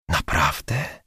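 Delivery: hushed, close-up asking. Acted. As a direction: drop the voice and ask again. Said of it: "ciszej zapytała Żaneta".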